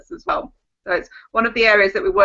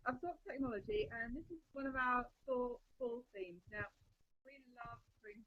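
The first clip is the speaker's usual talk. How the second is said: muffled, very faint behind a finger; mic covered, barely audible; finger over the mic; 30 dB quieter, faster than usual. Real, not acted. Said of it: "I keep covering the microphone with my finger".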